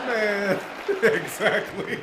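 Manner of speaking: slow, high, laughing agreement. Real, not acted. Agreeing with the comedian, slow and laughing. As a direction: agree while still laughing.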